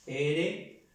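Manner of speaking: usual speaking voice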